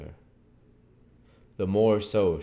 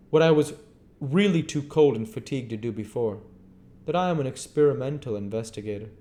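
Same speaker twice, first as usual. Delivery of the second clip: higher, curt stranger; stranger's voice; 8 semitones higher, 8 dB louder, faster than usual. Acted. Stranger answering Henfrey, higher curt voice. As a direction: play the aloof stranger, pitched up.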